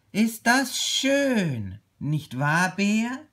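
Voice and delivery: high, drawn-out admiring little tiger; calling out in wonder. A narrator's reading. Little tiger admiring the view with Bear, calling out. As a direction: marvel aloud, stretching the praise. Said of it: "rief der kleine Tiger".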